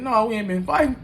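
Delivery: defensive high-pitched protest; high, loud denial. Retold or imitated. Telling police they weren't fighting, high loud protest. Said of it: "I said".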